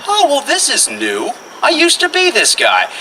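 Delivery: high-pitched inner voice; lightly hammed-up realization; mock musing. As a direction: act out a thought in a high voice. Acts out his thoughts at gunpoint.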